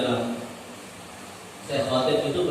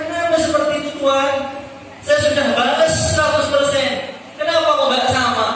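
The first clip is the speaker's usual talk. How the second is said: raised, higher pleading complaint; louder and higher, indignant questioning of the companion; loud protest; 10 semitones higher, 6 dB louder than usual. Acted. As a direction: cry out that it isn't fair.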